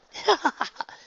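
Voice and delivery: high, loud performed chuckle; acted chuckle. A narrator's reading. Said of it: "Rarity chuckled".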